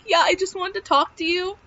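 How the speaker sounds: high-pitched retold tearful plea; retold breakdown. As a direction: imitate losing it, voice climbing high. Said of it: "I lost it".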